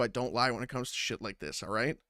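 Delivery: normal talking voice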